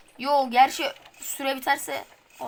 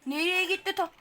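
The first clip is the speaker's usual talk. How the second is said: ordinary delivery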